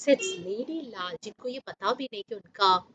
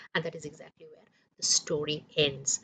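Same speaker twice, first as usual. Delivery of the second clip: whispered; 6 semitones lower, 7 dB quieter than usual. A narrator's reading. Story ending.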